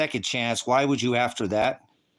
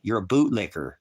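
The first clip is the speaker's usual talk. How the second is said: ordinary talk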